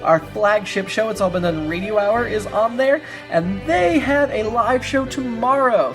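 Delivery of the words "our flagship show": ordinary talk, nothing marked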